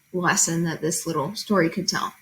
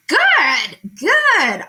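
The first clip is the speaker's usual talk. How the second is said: higher, louder eager cheer; mice shouting; 6 semitones higher, 5 dB louder than usual. Acted. Mice at the meeting agreeing, excited shout. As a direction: cheer the plan. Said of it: "all the mice shouted".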